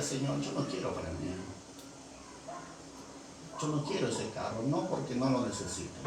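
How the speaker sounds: low, hushed acted plea; subdued, softly mournful praying; tearful prayer. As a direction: humbly pour it out to God. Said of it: "en eso que lloraba".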